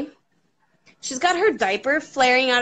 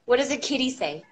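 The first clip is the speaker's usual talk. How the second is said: put-on coaxing baby talk; 4 semitones higher, faster than usual. Asking toddler animal sounds.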